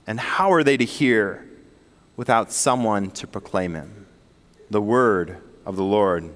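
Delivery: measured, solemn Bible reading; scripture reading. Acted. Scripture reading before sermon.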